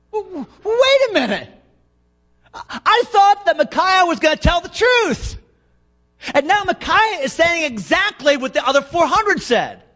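high-pitched, stammering pulpit disbelief; astonished preaching. Real, not acted. Preacher questioning Micaiah's answer to the king, high astonished preaching.

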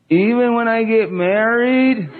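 lightly voiced small girl asking; a hint of a five-year-old's plea; little daughter voice. Daughter voiced again, laughing.

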